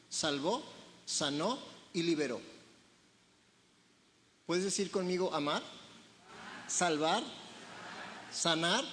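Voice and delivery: normal talking voice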